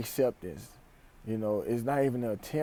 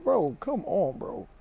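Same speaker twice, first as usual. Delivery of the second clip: a high, exasperated plea to the drivers; exasperated bro; 7 semitones higher, 3 dB louder, slower than usual. Retold or imitated. To people hitting the car alarm, exasperated. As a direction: come on, man, fed up and higher. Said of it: "I'm like".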